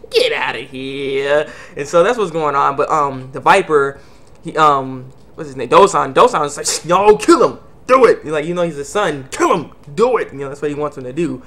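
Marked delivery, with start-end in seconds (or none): 0.1-1.5 slow, stretched GET OUTTA HERE; drawn-out dismissal. Retold or imitated. 6.8-8.2 loud, higher mock order; acted forceful urging; urgent command. Dosan urging his son. 9.3-10.3 acted forceful urging; urgent command. Dosan urging his son.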